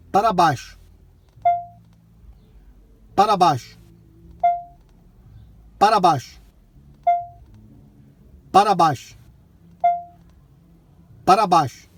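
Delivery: loud repeated command; talking to voice assistant. Real, not acted. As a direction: call it out to the car.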